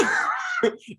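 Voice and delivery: very high squeal of laughter; high tail-end laugh. Real, not acted. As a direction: let a real laugh squeal out. Laughing on before resuming.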